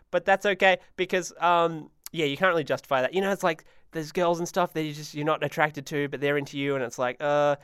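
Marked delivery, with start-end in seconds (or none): none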